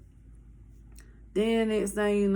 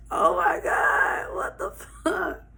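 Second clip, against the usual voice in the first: retold disbelief; 6 semitones higher, slower than usual. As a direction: gasp it out, stunned. Teller reacting to the telling-off.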